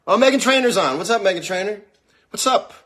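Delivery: high, loud hellos across the room; calling out greetings. Real, not acted. Host greeting the arriving guests loudly, calling out. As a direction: call out to the guests in the distance.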